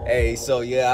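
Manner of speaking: ordinary delivery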